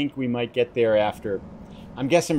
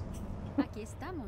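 soft laugh; 12 dB quieter than usual. Real, not acted. Chuckles at last time's fiasco.

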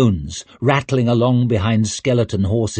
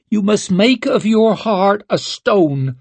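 high stern witch voice; 9 semitones higher than usual. Acted. Isern speaks.

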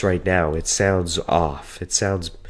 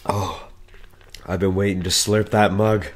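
ordinary delivery

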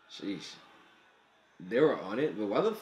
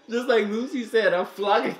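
hyped laughing; 7 semitones higher, 4 dB louder than usual. Real, not acted.

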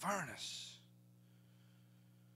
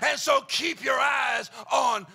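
loud rhythmic whooping preacher; full-force gospel whoop; preaching at full force; 6 semitones higher, 15 dB louder than usual. Acted. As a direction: ring it out to the congregation with a gasped uh.

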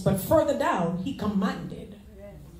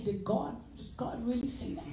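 much softer, a doubting hush; doubting whisper-soft question; 13 dB quieter than usual. Retold or imitated. Believer quietly doubting God's promise, softly. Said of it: "you get to the point you say".

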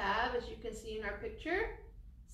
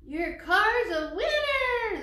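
high, loud, drawn-out proclamation; announcing coach; 9 semitones higher, 9 dB louder, slower than usual. A narrator's reading. Playground coach declares Teresa the winner, loud triumphant announcement. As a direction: proclaim the champion big and slow. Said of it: "the playground coach said".

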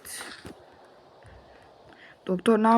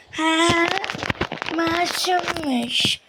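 high, slow drawn-out scoff; mock his innocence; sarcastic mocking; 9 semitones higher, much slower than usual. Scoffing at the prisoner's innocence, high slow sarcasm.

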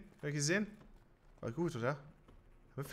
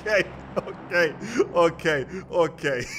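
shaken; 5 semitones higher, 9 dB louder than usual. Real, not acted. Shaken after scare.

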